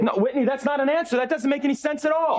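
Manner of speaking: high, exasperated outburst; angry yelling. Acted. Father rejects Whitney's excuse, yelling angrily. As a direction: throw the answer back at full pitch. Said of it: "can you just stop yelling at me".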